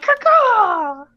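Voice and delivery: loud, high double caw; drawn-out mock crow cry; bird caw. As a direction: call into the distance like a crow. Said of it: "and I'm like".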